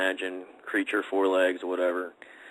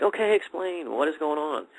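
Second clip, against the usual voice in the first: higher-pitched mock demand for answers; retold insistent demand; 8 semitones higher than usual. Man retelling how he pressed his girlfriend for an explanation, insistent. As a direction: re-enact pressing someone to explain.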